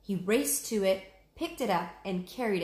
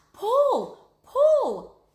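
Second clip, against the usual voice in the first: much higher, much louder cheering yell; urgent shouting; 11 dB louder than usual. Acted. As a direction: yell her up out of the mud. Jack urges Annie out.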